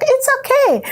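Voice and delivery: very high, cooing retold comfort; lilting high-pitched "there, there" tone; soothing reassurance. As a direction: imitate a kind woman consoling someone. Stranger soothes her when sad.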